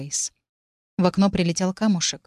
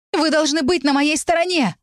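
shouting; 9 semitones higher than usual. A narrator's reading. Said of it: "с криками непонимания".

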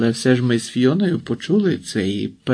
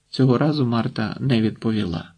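ordinary delivery, nothing marked

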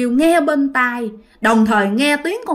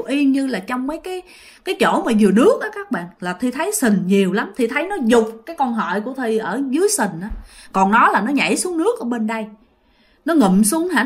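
usual speaking voice

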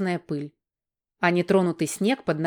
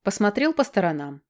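plain reading voice

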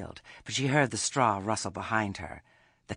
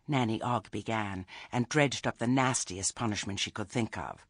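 plain reading voice

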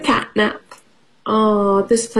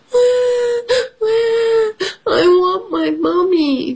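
very high wailing for mummy; baby mouse crying for her mother; mock baby crying; 13 semitones higher, slower than usual. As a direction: sob like a tiny lost child. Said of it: "cries Baby Mouse".

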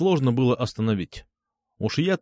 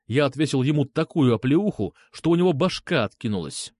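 ordinary delivery

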